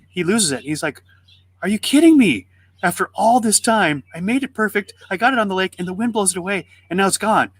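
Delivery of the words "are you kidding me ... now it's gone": put-on high-pitched, dismayed kid's protest; retold outrage, pitched well up; indignant disbelief. Boy loses his handmade boat.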